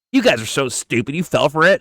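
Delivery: high, gloating mock taunt; mocking impression. Host imitating Timmy to his followers, high mocking gloat.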